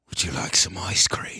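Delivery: low, menacing mock-British voice; UK accent. Comedian mocking British actors' sweet-sounding menace, low British accent. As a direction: offer it darkly in a British accent. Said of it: "it sounds like".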